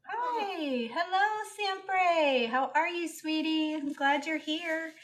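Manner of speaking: high, soft, affectionate welcome; sweet greeting. Real, not acted. Streamer greeting a returning viewer, softly cooing. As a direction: coo a gentle hello.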